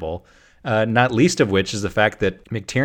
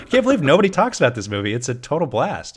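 usual speaking voice

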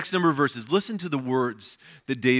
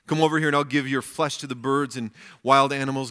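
ordinary talk, nothing marked

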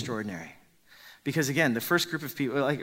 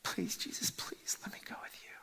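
much softer whispered begging; whispered plea; 11 dB quieter than usual. Acted. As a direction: barely breathe the plea. The man pleading to leave.

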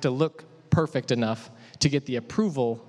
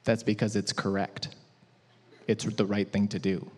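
calm mom; 5 semitones lower than usual. Retold or imitated. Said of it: "my mom looks at me and says".

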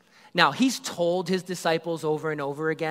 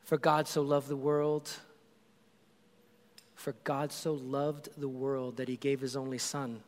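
reverent scripture; 3 semitones lower, 6 dB quieter than usual. Acted.

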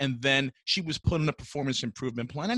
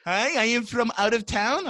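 a hint of a bad Southern accent, pitched up; mock Southern accent; 9 semitones higher, 3 dB louder than usual. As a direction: mimic his failed Southern accent. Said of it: "that's not a Southern accent".

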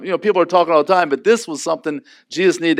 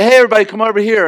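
quick, loud call to gather round, pitched up; a lively loud summons; 6 semitones higher, 6 dB louder, much faster than usual. Retold or imitated. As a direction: hail the whole room over to you. Calling everybody over.

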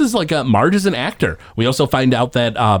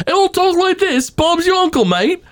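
high, put-on Cockney voice like a cartoon kid; mock Cockney. Podcast host quoting Bart's fake British voice, mock Cockney. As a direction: ham up a fake Cockney accent. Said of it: "Bart wants to speak in a different voice".

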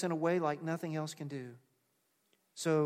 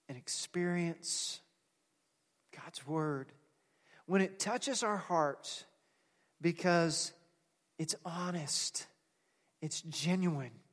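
usual speaking voice

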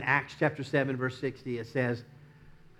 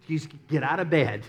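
stern push; 4 semitones higher, 7 dB louder than usual. Acted. God voiced scolding Saul.

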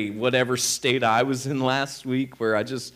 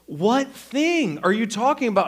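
higher, exasperated retold question; baffled wife; 7 semitones higher than usual. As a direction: imitate a wife woken and confused.

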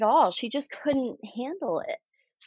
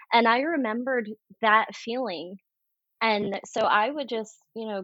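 ordinary talk, nothing marked

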